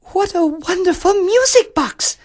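put-on very high, girlish exclamation; exclaiming princess. A narrator's reading. Princess mistakes bird for music box, high exclaiming. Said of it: "exclaimed the princess".

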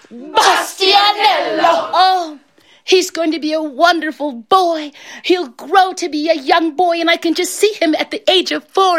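high, gushing delight; hammed-up elation, pitched high; excited. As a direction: gush as a proud mother-to-be dreaming aloud. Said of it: "She was so excited".

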